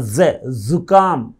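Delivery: ज़े, जुकाम drawn out slowly with a long buzzing z; stretched z sound. Shown as a demonstration. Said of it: "खींच के बोलना पड़ेगा".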